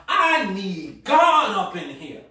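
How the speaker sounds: loud, slow, drawn-out declaration; forceful cry of need. Acted. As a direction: belt it out word by word, stretched. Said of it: "I'm not gonna cry out".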